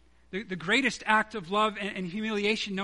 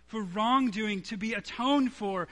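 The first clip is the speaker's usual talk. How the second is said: normal talking voice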